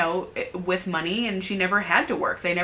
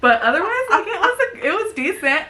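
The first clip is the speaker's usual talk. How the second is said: laughing; 12 semitones higher, 7 dB louder than usual. Real, not acted. Wrapping up the review through laughter. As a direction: still laughing, pitched much higher and louder. Laughing after pirate confession.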